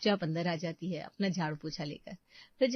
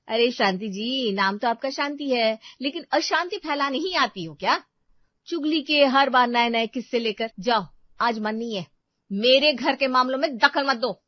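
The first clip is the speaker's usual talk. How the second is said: loud, higher sharp rebuke of the nosy neighbour; wife sending a gossiping neighbour packing, angrily; angry scolding; 5 semitones higher, 7 dB louder than usual. Acted. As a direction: tell her off, fed up. Seema snaps at neighbour.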